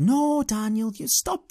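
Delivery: high-pitched chiding inner voice; voice of unreason. Retold or imitated. Inner voice telling him off, high and chiding. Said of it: "the voice of unreason came in".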